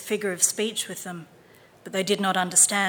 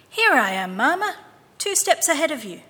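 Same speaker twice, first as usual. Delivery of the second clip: put-on playful little boy; 7 semitones higher, 6 dB louder than usual. A narrator's reading. Boy voiced in story.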